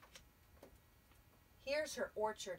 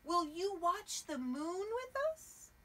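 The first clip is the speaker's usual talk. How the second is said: higher owl voice; a touch higher owl voice; 7 semitones higher, 3 dB louder than usual. Acted. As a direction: ask it like a gentle owl. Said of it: "called Owl".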